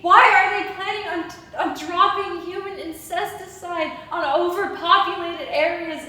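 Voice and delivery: loud, building outrage at heaven; desperate demand. Acted.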